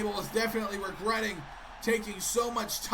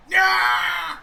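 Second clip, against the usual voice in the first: sudden loud high yell of joy; excited cheer; 7 semitones higher, 10 dB louder than usual. Real, not acted. Commentator cheering the comeback, loud high yell. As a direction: burst out cheering.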